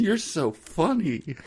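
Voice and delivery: high, slow, gushing coo; mock flirty. Co-host parodying Maria gushing at Uncle Roscoe, high and slow. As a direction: gush like a lovestruck fan.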